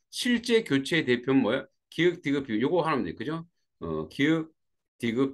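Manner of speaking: ordinary delivery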